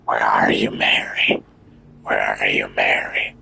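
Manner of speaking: low hushed possessed whisper. Retold or imitated. Gamer imitating the possessed-sounding character. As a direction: call out like a haunted soul. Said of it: "He talks like he's possessed".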